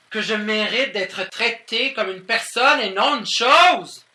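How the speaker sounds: high, loud plea for respect; indignant outburst. Acted. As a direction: build to an outraged peak. (indignation montante et forte)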